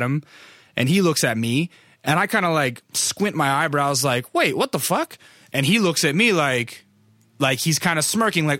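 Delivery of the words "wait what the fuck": high-pitched baffled double take; puzzled surprise, acted out; retold disbelief. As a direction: replay a startled double take. Retold double take on recognizing someone.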